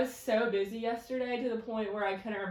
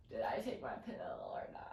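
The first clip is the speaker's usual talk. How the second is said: quiet; 9 semitones lower, 9 dB quieter than usual. Retold or imitated. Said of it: "I was just laying there like".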